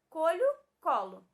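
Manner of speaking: "Colho" with a palatal lh, then "colo" with plain l; contrasted pronunciations. Shown as a demonstration. Teacher compares words for pupils, loud contrasted "Colho", "colo". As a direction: set "Colho" against "colo", loudly. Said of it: "Que mudança a letra H causou na pronúncia".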